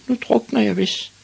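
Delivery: put-on very high, forlorn child voice; woeful high-pitched resignation; sighing small boy. A narrator's reading. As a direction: lament it in a high little voice. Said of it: "sukkede han".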